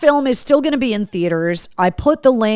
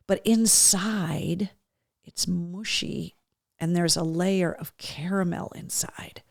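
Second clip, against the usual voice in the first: hushed savoring; 2 semitones lower, 7 dB quieter, slower than usual. Real, not acted.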